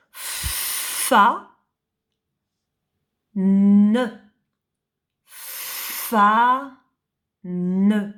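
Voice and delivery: Fa then ne split with pauses; syllable by syllable. Shown as a demonstration. Teacher breaking a dictated word into syllables. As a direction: sound out fan as two syllables. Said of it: "tu écris fan".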